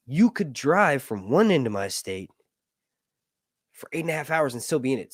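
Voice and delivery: ordinary talk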